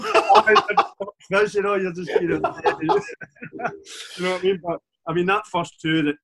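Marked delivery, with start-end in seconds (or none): 0.1-1.6 laughing at his own dig. Real, not acted. Repeats jibe while laughing. 1.6-4.6 laughing through the words, louder and higher; giggling loudly at his own jibe. Real, not acted. Repeats jibe while laughing.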